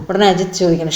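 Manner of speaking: usual speaking voice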